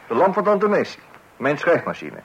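loud, high exasperated listing. Acted. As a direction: count off each loss with rising fury. Wife lists the damaged things, exasperated.

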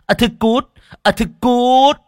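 high, loud angry tirade; furious scorn. A narrator's reading. Rich man casts out his pregnant lover, furious and contemptuous. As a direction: berate and throw her out, rising to a yell.

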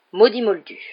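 loud, irritated grumble; muttered curse. A narrator's reading. Hagrid hears about the Dursleys, grumbling angrily. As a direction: curse them with a burst of annoyance. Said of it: "maugréa Hagrid".

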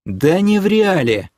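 high, drawn-out annoyed retort; irritated. A narrator's reading. Man correcting his alarmed girlfriend, irritated. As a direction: snap back in irritation. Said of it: "озлился я".

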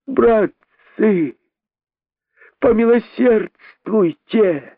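high slow begging wail; pleading cry. A narrator's reading. Rabbit begging the crowd for mercy, high pleading. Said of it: "закричал находчивый".

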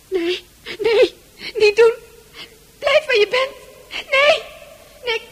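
high, loud terrified pleading; panicked cries. Acted. Francis crying out in panic as the hand approaches. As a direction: scream it away.

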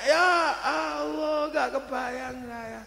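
mock despair. Overwhelmed by baby's mess.

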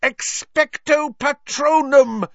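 slow, high, full-force shout; drawn-out shouted incantation; desperate yell. A narrator's reading. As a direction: stretch out the spell in a loud yell. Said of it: "Harry yelled".

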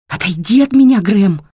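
high, loud shriek. Acted. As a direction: scream it in fear. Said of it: "Взвизгнула слишком громко".

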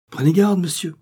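sharp, high alarmed warning; a groom's startled shout of warning; alarmed cry. Acted. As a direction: cry out in alarm to stop him. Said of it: "s'écria le valet alarmé".

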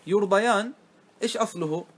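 usual speaking voice

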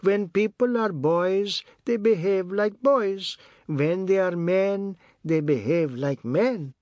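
high, slow matriarch voice; Missus Tulsi's sage pronouncement. A narrator's reading. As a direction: intone it like a proverb, high and drawn out.

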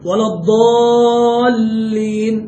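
الضالين with the lam doubled; correct recitation. Shown as a demonstration. Teacher giving the correct reading before the wrong one. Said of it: "مثلا".

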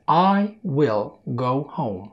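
spoken slowly, word by word; slow example sentence. Shown as a demonstration. Teacher gives learners an example sentence, slow and careful. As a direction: say "I will go home" slowly and clearly. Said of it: "جملة تانية مثلًا زي دي".

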